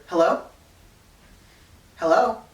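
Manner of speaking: ordinary talk, nothing marked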